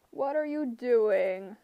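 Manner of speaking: slow, loud, demanding Cyclops; demanding monster. A narrator's reading. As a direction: lean on each word like an angry giant. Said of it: "the monster demanded".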